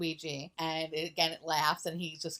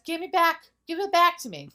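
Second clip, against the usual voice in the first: much higher and louder villain voice; creepy villain demand; 10 semitones higher, 9 dB louder than usual. Retold or imitated. Villain on the balloon, high loud demanding voice. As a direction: demand it back menacingly.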